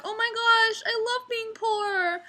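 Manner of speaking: high, slow, cooing fake-sweet voice; mock gushing customer. Waitress mocking a customer, high fake-sweet coo. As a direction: coo it like a clueless rich diner.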